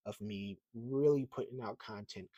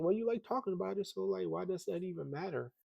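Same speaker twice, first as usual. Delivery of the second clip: much higher, mock questioning voice; challenging inner voice; 9 semitones higher than usual. Arguing with himself about views, in a higher challenging voice. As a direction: play your own doubter talking back.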